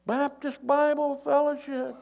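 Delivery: high, loud call from above; acted distant shout; yelling down. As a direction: holler the answer down to the ground. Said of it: "And the guy yells down".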